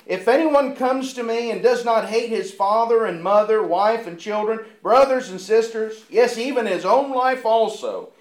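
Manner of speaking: loud, rising preacher's reading; fervent pulpit reading of Luke; scripture read with force. Acted. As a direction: proclaim it to the congregation. Preacher reads scripture aloud.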